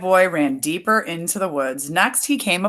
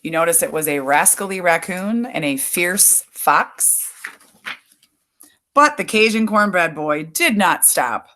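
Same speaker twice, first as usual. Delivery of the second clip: usual speaking voice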